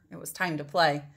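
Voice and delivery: ordinary delivery, nothing marked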